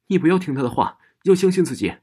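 high, loud urgent call; urgent shout. A narrator's reading. Friend bursts in, urges roommate loudly to believe herself. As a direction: shout it across the room to save her.

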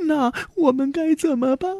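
put-on high, worried girl's voice; anxious question. A narrator's reading. Frightened daughter asks what to do.

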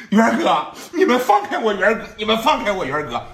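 high, desperate cries for help; panicked girl calling her brother; frantic crying out. Acted. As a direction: plead high and fast as she runs. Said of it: "一边往下跑一边喊".

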